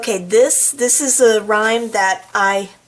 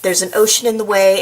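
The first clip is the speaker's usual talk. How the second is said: plain reading voice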